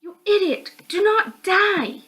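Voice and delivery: put-on high, loud, slow yelling girl; angry worried call. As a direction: shout at him not to die. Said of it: "the voice of Ashley calling me".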